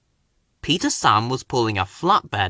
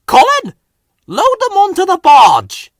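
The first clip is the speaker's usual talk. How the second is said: much higher, much louder furious shout; angry shout; 11 dB louder than usual. A narrator's reading. Controller finding party cargo at the wharf, furious shout. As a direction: yell it, outraged. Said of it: "the Finn controller shouted".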